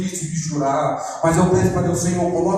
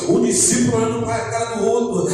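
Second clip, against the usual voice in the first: high-pitched, driving sermon delivery; impassioned preaching; 5 semitones higher than usual. Acted. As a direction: preach it with urgent, raised pitch. Preaches at full force.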